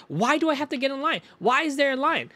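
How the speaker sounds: high complaining voice; mock customer whine. Imitating the customer arguing about the line, in a high whine. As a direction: whine each question up high. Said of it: "She was like".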